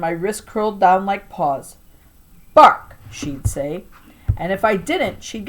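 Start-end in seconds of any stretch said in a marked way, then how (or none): 2.5-3.0 command. A narrator's reading. Grandma commanding puppy tricks.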